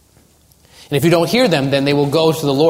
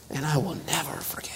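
very soft, bitter mock whisper; hushed, hammed-up unforgiveness; whispered grudge; 15 dB quieter than usual. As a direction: whisper it like a vow of resentment. Acting out a bitter grudge-holder.

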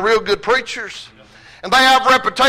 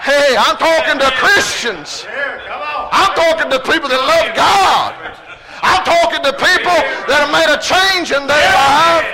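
preaching at full force; 4 semitones higher than usual. Acted. Building sermon climax with shouts.

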